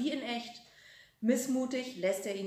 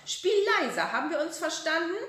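much higher, louder nagging, faster at the end; scolding; 8 semitones higher, 6 dB louder than usual. A narrator's reading. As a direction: nag him sharply. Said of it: "zetert die Oma".

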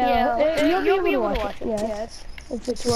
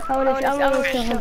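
ordinary talk, nothing marked

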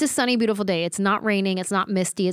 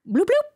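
high-pitched two-note electronic bloop; soundbar chime imitation; 14 semitones higher, 5 dB louder than usual. Podcaster imitating her soundbar turning on, high chime noise. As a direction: imitate a gadget switching on, high and louder.

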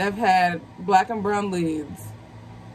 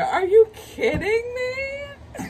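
giddy disbelief; slower than usual. Real, not acted. Fan disbelief at casting.

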